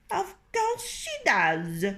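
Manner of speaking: high, sniping hen voices; fussing hens. Acted. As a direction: mutter it like jealous hens, high. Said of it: "they fussed".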